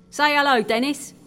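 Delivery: cheery nudge to say hello; prompting a mate. A narrator's reading. Sailor prompting sleepy shipmate to greet visitor, brisk.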